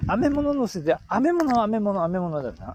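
lively Tsugaru dialect. Acted. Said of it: "あ、標準語に変わった。".

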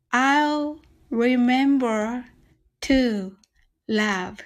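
very slow, each word drawn out; slow demo. Shown as a demonstration. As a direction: stretch every word for learners. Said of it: "一緒にゆっくり練習してみましょう". (ゆっくり一語ずつ)

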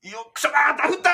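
high shout of a duffed shot; pained golfer shout. Pretend. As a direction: yell in dismay at the shot. Acted botched golf shot.

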